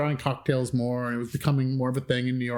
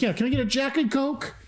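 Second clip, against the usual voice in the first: bright, much higher eager order; eager young self; 10 semitones higher than usual. Retold or imitated. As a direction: order like a keen nineteen-year-old. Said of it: "and I was like".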